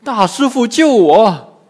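high, loud frightened plea; panicked cry to a master; trembling plea. A narrator's reading. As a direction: beg for rescue in alarm. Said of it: "颤抖着说".

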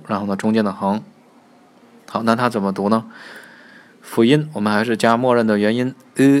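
ordinary delivery

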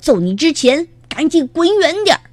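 high-pitched angry yell; furious young rooster shouting. A narrator's reading. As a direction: yell it to chase someone off. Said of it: "愤怒地喊道".

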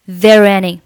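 "there any" run together through the r; linked there are any. Shown as a demonstration. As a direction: link "there any" in one breath, no breaks. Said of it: "可以构成辅元连读".

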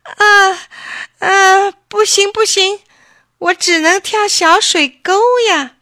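high, loud, panicked wail; a terrified little rabbit close to tears; nearly crying. A narrator's reading. Rabbit begs off jumping the river, frightened and tearful. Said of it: "兔子几乎要吓哭了".

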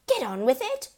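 high, loud impatient order; hissed impatience. A narrator's reading. Mother pushes her reluctant husband, impatient. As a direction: urge him to hurry. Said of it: "hissed the mother".

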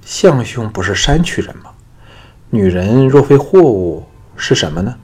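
taken aback, voice lifting; astonished. A narrator's reading. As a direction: ask in real amazement, punching the words. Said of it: "李善大奇道".

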